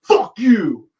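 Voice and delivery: loud, high angry shout; imitating a man's furious outburst; retold yell. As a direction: yell it like an enraged heckler. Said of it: "he just yells".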